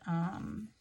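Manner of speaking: ordinary delivery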